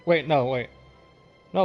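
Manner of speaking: ordinary delivery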